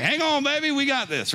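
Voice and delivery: put-on high, excited "hang on, baby"; playful reassurance. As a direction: cheer her on down the slide.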